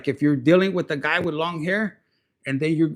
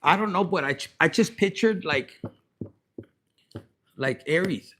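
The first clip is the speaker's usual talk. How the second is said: normal talking voice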